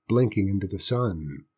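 ordinary delivery, nothing marked

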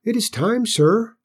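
put-on high-pitched little boy; small, high pipe of a boy answering his boss; clear, squeaky voice. A narrator's reading. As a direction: squeak it politely but clearly. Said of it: "in a clear but squeaky voice".

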